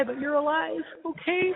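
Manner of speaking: choked up, pitch climbing high; fighting back tears on the words; real tears pushing into the voice; voice breaking. Real, not acted. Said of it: "this is gonna make me cry".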